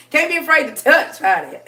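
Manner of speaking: laughing through the words; giggling while giving the tip; high laugh-filled line. Real, not acted. Laughing advice.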